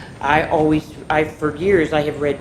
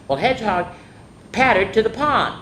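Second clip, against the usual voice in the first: plain reading voice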